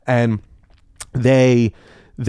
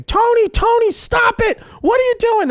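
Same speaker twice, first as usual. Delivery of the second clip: very high, loud mock scream; panicked high-pitched yell, imitating mom; screaming mother; 4 dB louder than usual. Son imitates mother yelling at dad mid-fight, screaming. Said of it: "I hear my mother screaming".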